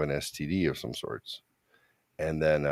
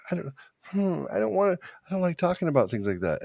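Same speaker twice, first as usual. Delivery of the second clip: freaking out; 12 semitones higher than usual. Retold or imitated. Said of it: "freaking out about this idea".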